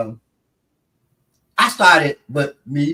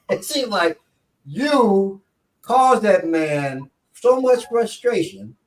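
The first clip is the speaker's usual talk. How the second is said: ordinary talk, nothing marked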